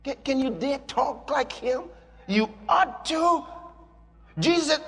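ordinary talk, nothing marked

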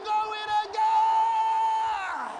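slow, high, drawn-out shout of glory; shouted praise. Real, not acted. Preacher to congregation, long ecstatic shout. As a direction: stretch the praise out at the top of the voice. Preacher's climax over music.

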